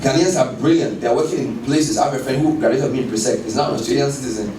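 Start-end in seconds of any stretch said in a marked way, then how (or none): none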